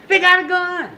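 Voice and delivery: high alarmed warning shout; officer spotting the gun, high alarmed yell. Retold or imitated. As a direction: warn the others in a panic.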